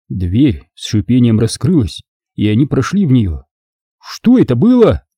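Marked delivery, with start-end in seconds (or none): 4.0-5.0 high, wondering question; curious. A narrator's reading. Thief asking about the mind trick, curious. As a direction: ask with keen curiosity, pitched way up. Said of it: "с любопытством спросил Дэн".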